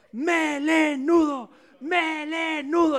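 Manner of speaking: high, slow, loud taunting "¡Melenudo!"; monkeys shouting. Acted. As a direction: jeer it up at the lion. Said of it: "le gritaban todos los monos". (monos gritan "¡Melenudo!" al león, fuerte y agudo)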